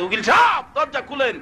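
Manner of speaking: calling out. Acted. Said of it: "উকিলের বাড়ি ডাকতে".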